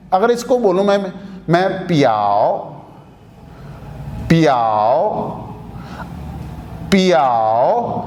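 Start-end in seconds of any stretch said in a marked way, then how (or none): 1.9-8.0 پیاؤ drawn out slowly with the a opened wide; پیاؤ, long open a; slow open a in piao. Shown as a demonstration. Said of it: "آؤ کی ساؤنڈ، اے کی ساؤنڈ آ رہی ہے".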